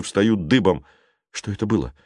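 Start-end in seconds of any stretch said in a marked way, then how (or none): none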